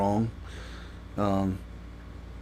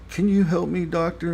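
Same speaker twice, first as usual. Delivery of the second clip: high, pitiful imitation of a plea; mock pleading; 7 semitones higher than usual. Mocking the crying man's plea.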